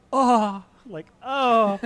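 high, loud staged gasp; theatrical gasp. Pretend. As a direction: gasp like a posed painting. Mimics posed painting.

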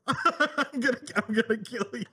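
laughing through a mock threat. Real, not acted. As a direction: threaten while cracking up.

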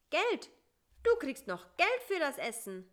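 high, loud reminder; insistent exclamation. A narrator's reading. Fly reminds beetle about payment, high and insistent. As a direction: call it out high and emphatically.

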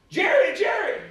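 put-on high, loud child's cry of a name; excited little girl calling. Little girl running to the preacher, calling high and loud. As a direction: call out like a thrilled little girl spotting him.

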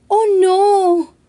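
a mock-sad girl gasping oh no; sad sigh. A narrator's reading. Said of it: "suspiró la niña mostrándose triste".

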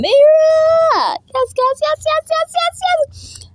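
very high, squealed yeses; excited squeal. Real, not acted. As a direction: shriek yes over and over in glee. Squealed repeated yes.

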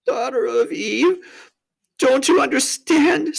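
high, loud pleading; hammed-up tearful appeal; distraught faun. A narrator's reading. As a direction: wail it in despair. Tumnus pleads with Lucy.

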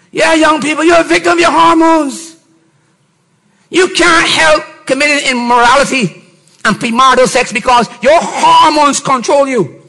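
ordinary talk

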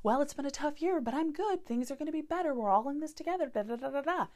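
high, breezy, forced cheerfulness; mock upbeat script. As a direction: rattle off the brave-face answer everyone gives. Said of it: "jump into this script of like".

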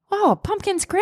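high-pitched voice of pleased friends; high mock approval; friend group's reaction. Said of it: "and everyone's like".

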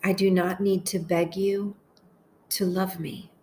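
slow, measured meditation voice with long pauses; unhurried, deliberate phrases for listeners to echo; guided prayer meditation. Acted. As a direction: pray each short phrase slowly, leaving space to repeat it.

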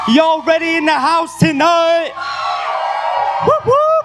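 loud, high event-opener shout; belted high-energy welcome; hype shout. Real, not acted. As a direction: fire up a big crowd with a whooping welcome. Pastor hyping up event crowd.

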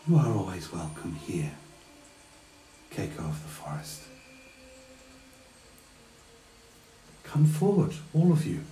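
lilting queen's greeting, pitch rising and falling; sing-song. Acted. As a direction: sing it lightly like a gracious fae queen. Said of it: "in an almost sing-song voice".

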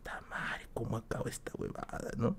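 casi sin voz, agobiado; susurro desesperado. Retold or imitated. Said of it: "Y yo estaba ahí". (susurro desesperado frente a la olla)

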